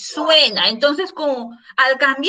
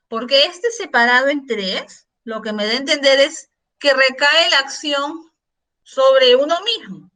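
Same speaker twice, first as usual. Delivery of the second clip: ordinary talk, nothing marked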